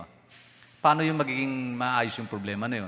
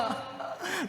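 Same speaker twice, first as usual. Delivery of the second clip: high squealing laugh; 9 dB quieter than usual. Real, not acted. Laughter mid-story.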